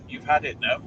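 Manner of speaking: ordinary talk, nothing marked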